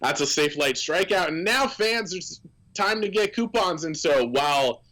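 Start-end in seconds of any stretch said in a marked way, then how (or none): 0.0-3.8 a bit higher announcer promo read; lightly voiced PA announcer. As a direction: pitch the coupon promo like a ballpark announcer. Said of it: "Craig turns it on his mic and says".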